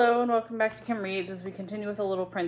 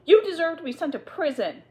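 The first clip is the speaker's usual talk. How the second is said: high, loud furious yell; screaming; 9 semitones higher, 6 dB louder than usual. Acted. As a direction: hurl the threat at her. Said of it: "Miss Minchin screamed".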